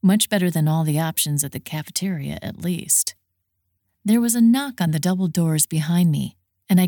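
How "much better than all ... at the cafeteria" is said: usual speaking voice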